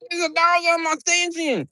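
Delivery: high squeaky mock panic; mock startled friend. Retelling a startled friend quote.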